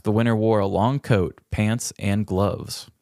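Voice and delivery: usual speaking voice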